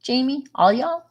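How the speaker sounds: "all you all" and "all y'all" asked high; high, puzzled check of "all y'all"; quizzical y'all. Shown as a demonstration. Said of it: "is there such a thing as".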